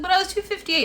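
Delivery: high, insistent objection; retold high-pitched protest; indignant protest. Retelling her protest at weigh-in.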